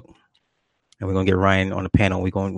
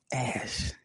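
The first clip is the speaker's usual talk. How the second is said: whispered under the breath; whispered insult; 4 semitones higher, 14 dB quieter than usual. Real, not acted. Muttered insult after muting mic.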